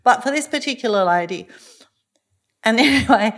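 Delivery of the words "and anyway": laughing nervously through it; a real, uneasy chuckle. Real, not acted. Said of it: "That's probably a bit of a nervous laugh".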